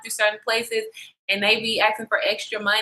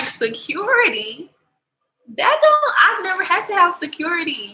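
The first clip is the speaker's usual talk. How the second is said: high, shocked disbelief; can't believe what she's hearing; incredulous; 9 semitones higher than usual. Retold or imitated. Retelling her disbelief at needing security.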